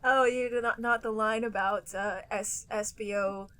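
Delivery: usual speaking voice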